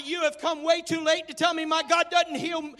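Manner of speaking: loud, rising preacher's cry; fiery pulpit shout; preaching at full force. Acted. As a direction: preach it out to the congregation. Preacher proclaiming his motto.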